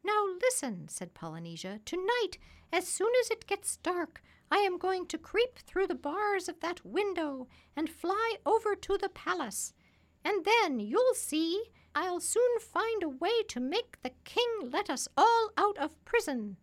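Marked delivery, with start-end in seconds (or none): 0.0-0.9 high, loud parrot voice; high-pitched talking parrot; bossy parrot. A narrator's reading. 1.8-16.6 high, loud parrot voice; high-pitched talking parrot; bossy parrot. A narrator's reading.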